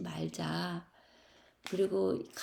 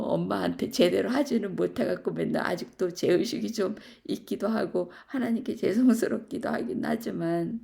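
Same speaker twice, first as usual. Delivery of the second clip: usual speaking voice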